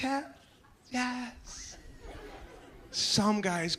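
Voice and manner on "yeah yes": soft, airy giddy yes; breathy yes. Retold or imitated. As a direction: whisper a thrilled yes. Girl accepts ring.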